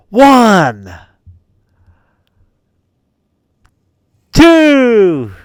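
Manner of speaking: high, loud, long falling counts; imitated referee count. Player refereeing the friend's pin, loud stretched count.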